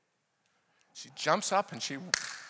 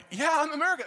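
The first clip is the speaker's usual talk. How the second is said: excited reply; 11 semitones higher, 6 dB louder than usual. Retold or imitated. Retold excited exchange.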